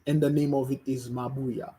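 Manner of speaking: ordinary talk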